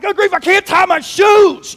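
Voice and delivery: high, drawn-out exasperated cry; exasperated yell. Acted. Player missing the game over laces, exasperated yell. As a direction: wail it in comic panic. Dreamer panics as game starts.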